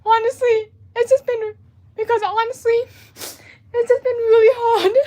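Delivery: high, tearful voice, breaking into sobs; real crying. Real, not acted. Artist confiding to viewers how hard it has been, crying. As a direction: fight back tears and lose, pitch climbing. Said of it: "I'm just trying not to cry".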